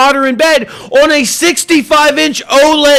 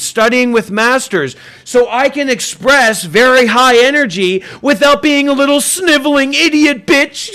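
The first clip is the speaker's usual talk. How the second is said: normal talking voice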